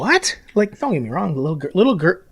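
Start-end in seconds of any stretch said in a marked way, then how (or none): 0.0-0.4 high, disbelieving what; incredulous what. Real, not acted. Podcaster reacting to a silly double standard, high incredulous what. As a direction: squeak it out in disbelief.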